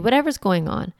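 ordinary talk

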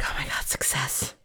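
hushed, excited whisper to oneself; soft, breath-only celebration; whispered triumph. Retold or imitated. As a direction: whisper a secret victory. Secret triumph as client sleeps.

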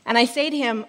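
ordinary talk

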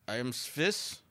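put-on clipped German accent posing as Swiss; lightly put-on German accent, stiff and formal. German newcomer insisting to neighbors he is Swiss, German accent. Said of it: "and an accent".

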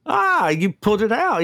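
high, delighted "ah" of surprise; impressed exclamation. Real, not acted. Host cheers a contestant's lucky answer, impressed. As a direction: react as if they just pulled off a tough one.

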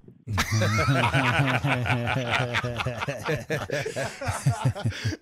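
real belly laugh in a long run; long hearty laugh. Real, not acted. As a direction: crack up at the punchline. Laughs after the punchline.

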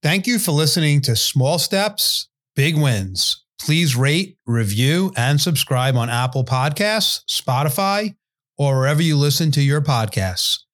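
upbeat, lifted outro read; bright host sign-off voice; podcast outro. Acted.

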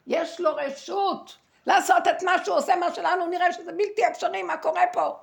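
very loud, high-pitched outburst; real fervent yell, pitched high and sustained; impassioned shouting. Real, not acted. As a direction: shout it out with full conviction. Impassioned outburst about heaven's permission.

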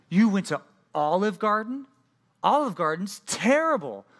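high, loud disbelief, slow on terrible; a coworker who can't believe it; incredulous coworker. Retold or imitated. Coworker shocked at Olive Garden choice.

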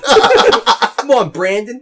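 high, loud mock Southern call; hammed-up Southern come-on, half laughing; Southern accent. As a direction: holler it in a put-on Southern accent. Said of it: "all of a sudden accent".

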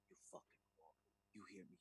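hushed, low mock threat under the breath; trying to be slick. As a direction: whisper it menacingly, imitating a furious boyfriend. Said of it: "real trying to be slick".

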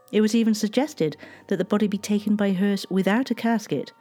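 plain reading voice

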